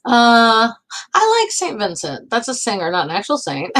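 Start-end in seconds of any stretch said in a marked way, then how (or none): none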